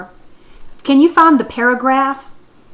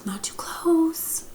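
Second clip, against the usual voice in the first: slow, soft whispered warning; hushed whisper, slowed right down; 5 semitones higher, 10 dB quieter, slower than usual. Shown as a demonstration. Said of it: "or maybe he said".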